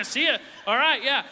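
mock loud call over the shoulder, walking out; calling out leaving. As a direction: call it back toward the kid's room while leaving. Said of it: "I'm like".

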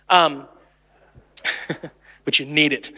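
ordinary talk

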